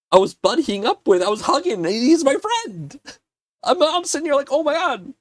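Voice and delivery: high, starstruck gush; excited gushing. Real, not acted. Fan gushing about hugging his idol, high and excited. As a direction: bubble over about meeting your hero.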